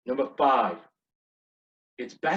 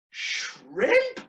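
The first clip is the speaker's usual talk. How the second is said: very high, squeaked question; hammed-up baffled server, pitched way up; puzzled server; 4 dB louder than usual. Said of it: "And he said".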